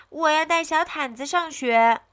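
put-on high-pitched child voice, insisting; insistent little boy. A narrator's reading. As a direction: plead like a stubborn small boy. 阿文坚持要带毯子上学. (小男孩撒娇地坚持)

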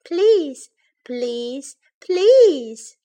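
drawn-out begging, first and last pleas soaring. A narrator's reading. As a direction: plead like an insistent child. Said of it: "begged Baby Bear".

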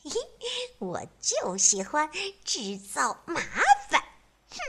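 high, hushed naughty snicker; sneaky giggle. Acted. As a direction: giggle behind the tree. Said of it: "偷偷地笑".

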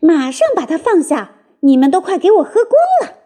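high, indignant squealing; rat's shriek. A narrator's reading. Rat ordering thieves off its cider, high shriek. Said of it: "老鼠尖叫道".

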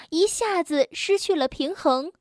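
ordinary delivery, nothing marked

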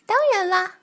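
high, loud chorus shouting together; many voices in unison. Acted. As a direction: everyone answers at once, eagerly. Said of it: "他们异口同声地答道".

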